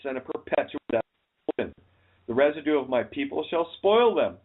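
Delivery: solemn Bible reading; scripture reading. Acted. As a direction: read it like scripture. Reads scripture aloud.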